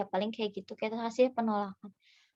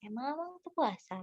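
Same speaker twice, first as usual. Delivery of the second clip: "Emang Amang teu puasa?" in a softer, lilting Sundanese tune; Sundanese questioning tune; 3 semitones higher, 9 dB quieter than usual. Shown as a demonstration. Stall keeper asking a customer about fasting, lilting Sundanese questioning tune. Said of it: "orang Sunda mah ya kalau nanyain kayak suka nadanya kayak".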